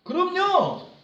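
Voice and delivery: high, bright agreement pushed way up; an enthusiastic sure thing; eager yes. Acted. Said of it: "너무나 긍정적이잖아요". (아주 높은 목소리로 신나게 대답하기)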